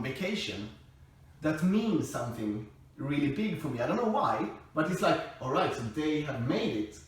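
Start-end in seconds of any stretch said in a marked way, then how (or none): none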